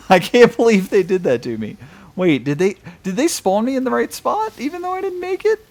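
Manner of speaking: loud, rising high disbelief; disbelieving. Real, not acted. Surprised game got lucky.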